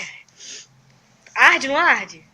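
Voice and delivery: usual speaking voice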